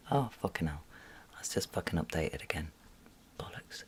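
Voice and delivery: annoyed whisper; whispered grumble. Real, not acted. Annoyed at the app updating, whispered. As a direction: swear it under the breath.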